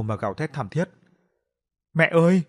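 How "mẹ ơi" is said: high, loud cry for mother; anguished wail. A narrator's reading. Son crying out over his dead mother, loud wail. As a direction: scream it as she dies in your arms. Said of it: "Tiếng gào khóc".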